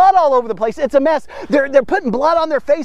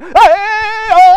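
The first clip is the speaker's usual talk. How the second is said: frenzied shout; 10 semitones higher, 7 dB louder than usual. Retold or imitated. Baal worshipers crying out.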